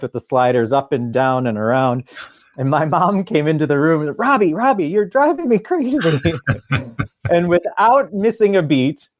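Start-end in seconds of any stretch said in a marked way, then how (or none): 4.2-6.0 high, exasperated mother voice; a mom at the end of her rope. Retold or imitated. Mom scolding child in story.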